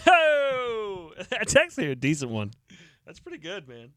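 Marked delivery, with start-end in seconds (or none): 3.0-3.9 softly muttered to himself, much softer; quiet self-praise. Real, not acted. Host privately praising his own tweet, muttered softly. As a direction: mutter the compliment under your breath. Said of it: "Talking to myself".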